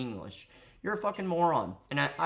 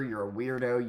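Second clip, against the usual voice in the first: ordinary talk, nothing marked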